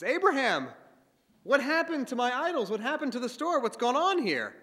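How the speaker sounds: high, loud outburst at his son; father yelling over the broken idols; angry father. Acted. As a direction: shout at the boy in shock and rage. Father discovers smashed idols.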